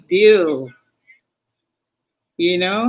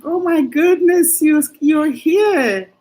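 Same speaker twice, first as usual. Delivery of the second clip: higher, delighted oh my goodness; delighted surprise; 5 semitones higher than usual. Real, not acted. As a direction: gasp with joy at a friend arriving. Spots Jadine in the chat.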